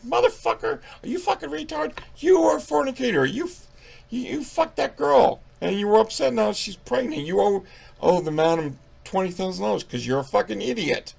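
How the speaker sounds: high-pitched, squealing comic tirade; indignant rant. Pretend. Reviewer berating the hypocritical priest, indignant.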